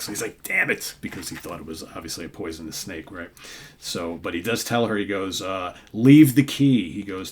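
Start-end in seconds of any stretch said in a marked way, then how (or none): 0.4-0.9 a sudden high yelp of frustration; fooled thief cursing his luck, pitched much higher; mock exasperation. Reviewer voicing the tricked brigand, high frustrated yelp. 5.9-6.9 a louder, higher barked order; the brigand calls after Sonja to leave the key, loud command; mock demand.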